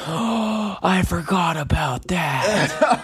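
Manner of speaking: slow, high, gasped realisation; gasp, then drag it out, mortified; mock horror. Roommate just teased about his slip, horrified realisation.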